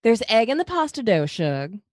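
put-on high, sweet Southern belle accent. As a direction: coo it like an affectionate Southern belle. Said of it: "attempting the affectionate voice of a Southern belle".

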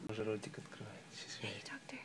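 soft whispered greeting. Real, not acted. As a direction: breathe it softly. Whispering to the baby.

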